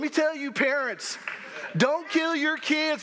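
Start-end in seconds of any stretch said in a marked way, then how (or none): 1.8-3.0 slow, high teasing warning; mock warning. Pastor teases parents about their kids, slow and high. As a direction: drag it out as a playful threat.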